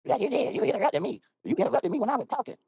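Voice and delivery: high mocking woman voice; imitating the woman who says he interrupted her, high and whiny. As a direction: whine it back like the woman accusing him. Quarrel, voicing the woman.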